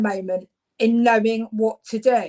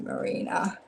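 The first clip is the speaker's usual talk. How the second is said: whispered name; 4 semitones lower, 9 dB quieter than usual. Real, not acted. Savouring the name to herself.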